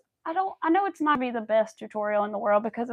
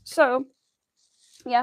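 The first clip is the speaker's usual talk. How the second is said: ordinary delivery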